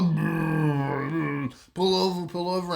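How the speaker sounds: gagging as he begs to pull over; retch, then urgently ask to stop; retching noise. Retold or imitated. Wado about to vomit.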